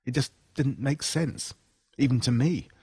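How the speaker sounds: plain reading voice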